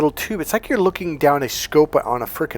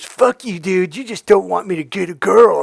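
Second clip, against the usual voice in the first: higher, louder mock protest; imitated indignant friend; 5 semitones higher, 5 dB louder than usual. Coach imitating friend Andrew protesting, indignant. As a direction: act out a buddy's angry retort. Friend's angry retort acted out.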